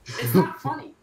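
annoyed, breaking into laughs; anger turning to laughter. Real, not acted. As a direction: scold while cracking up. Boat hits rocks, laughing protest.

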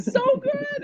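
high, gushing praise; puppy praise. Pretend. Praising the puppy in a high, excited voice. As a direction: coo it to a good puppy.

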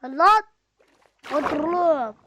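loud high shout; yell. Real, not acted. Yelling at the villagers to sit down. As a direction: bark the order out.

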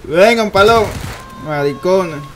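excited taunting. Real, not acted. Taunting enemies, loud.

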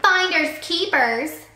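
slow teasing call, pitched high; a taunting singsong call; called over the shoulder. A narrator's reading. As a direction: fling his words back at him. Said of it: "called over her shoulder".

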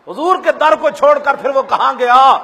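impassioned recital of a devotional couplet; declaimed poem. Acted. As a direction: thunder out the closing verse. Closing couplet declaimed loudly.

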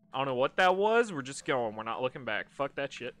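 loud shaken outburst; startled fear. Real, not acted. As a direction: flee and refuse to look. Loud fleeing reaction.

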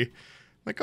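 whispered. Real, not acted. Whispered aside in story.

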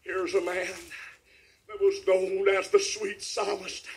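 ordinary talk, nothing marked

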